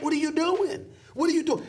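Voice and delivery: high exasperated "What are you doing?". Retold or imitated. Commentator scolding players who blew chances, exasperated. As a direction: throw up your hands in disbelief. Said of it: "I'm like".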